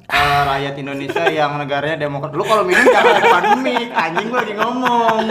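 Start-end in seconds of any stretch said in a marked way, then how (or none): 2.3-5.2 mock scolding. Scolding friend drinking at mic.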